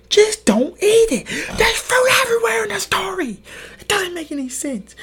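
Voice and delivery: mock childhood outrage. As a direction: scold Eve like an irritated kid. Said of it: "I'm like".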